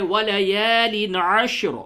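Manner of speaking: بلند آواز میں تلاوت; recited verse. Shown as a demonstration. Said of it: "آیت نمبر دو ہے".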